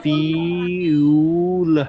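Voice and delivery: ফিউল drawn out very slowly as three parts, fi-u-l; feel stretched out slowly. Shown as a demonstration. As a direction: stretch ফিউল slowly so each part is heard. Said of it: "সেটা যদি একটু slow করে বলতাম".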